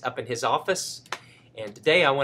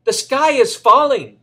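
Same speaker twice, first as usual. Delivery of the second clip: much higher and louder, a panicked shriek of a cry; frantic cry; 10 semitones higher, 12 dB louder, slower than usual. Acted. Chicken Little crying out to the fox, frantic loud cry. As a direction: cry out the alarm in full panic. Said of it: "cried Chicken Little".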